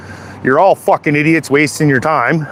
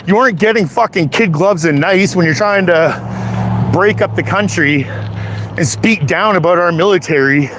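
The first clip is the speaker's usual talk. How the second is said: usual speaking voice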